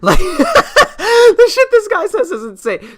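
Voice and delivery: high, loud, slow laugh through the words; laughing in disbelief. Real, not acted. Laughing at the clip, loud and slow in disbelief. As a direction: burst out laughing at the absurdity.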